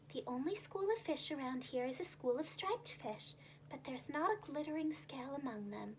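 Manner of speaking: quiet calm scallop voice. Acted. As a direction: answer thoughtfully and calmly.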